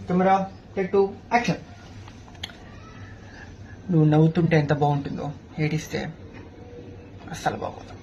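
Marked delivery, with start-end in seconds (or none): 3.9-7.9 soft, tender love lines for the heroine; romantic film hero. Acted. Actor on set reciting love lines to the heroine, softly. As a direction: woo her gently, like a film hero. Take two of film dialogue.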